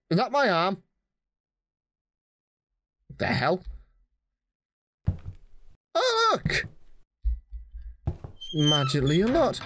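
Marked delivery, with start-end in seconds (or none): 5.9-6.7 falsetto squeal of fake delight; mock high voice. Gamer spotting an item, squeaky mock surprise.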